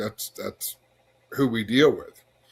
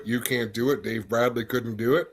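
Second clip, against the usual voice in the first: usual speaking voice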